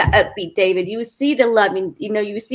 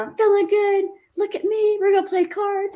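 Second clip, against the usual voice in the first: higher-pitched, perky take on her husband; cheery husband imitation; 8 semitones higher than usual. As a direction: bounce it up like a proud sick man showing off.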